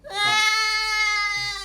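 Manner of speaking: put-on high startled yelp of a scared kid; startled child yelp. Scared kid yelps at the stranger.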